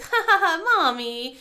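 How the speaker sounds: loud, high imitation of a kid laughing it off; mock child laugh. Daughter laughing off mom's school remark, mock giggly.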